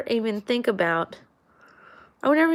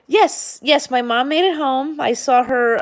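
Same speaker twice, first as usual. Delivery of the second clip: excited; 5 semitones higher, 5 dB louder than usual. Real, not acted. Happy news.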